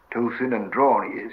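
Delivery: plain reading voice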